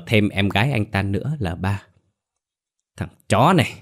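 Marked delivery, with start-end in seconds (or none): 3.0-3.7 through gritted teeth. Acted. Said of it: "nghiến răng ken két".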